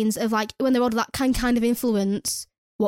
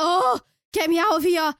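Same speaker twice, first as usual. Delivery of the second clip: high, drawn-out exasperated wail; retold cry of wanting out, slow and raised; exasperated plea; 5 semitones higher than usual. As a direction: groan it out like you can't take any more. Retold desperate plea to escape.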